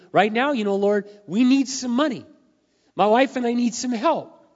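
loud pleading. Retold or imitated. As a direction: cry out to God for help. Desperate retold prayer to God.

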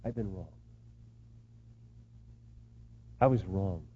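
low, soft confession with long pause; tearful admission, understated. Acted. Father confessing to his seated family, subdued and contrite. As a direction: drop the voice and admit it humbly. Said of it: "with tears in our eyes say".

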